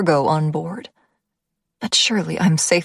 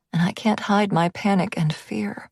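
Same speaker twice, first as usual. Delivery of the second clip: plain reading voice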